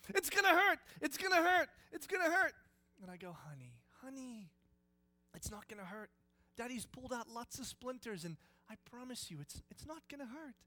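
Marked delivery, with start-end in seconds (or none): 0.1-2.5 put-on panicky child. Child refusing splinter removal. 3.3-10.5 soothing dad. Retold or imitated. Dad soothing frightened daughter.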